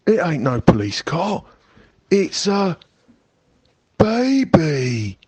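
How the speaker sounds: put-on stunned burglar finds a baby; amazed discovery. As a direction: let the wonder build to the last word.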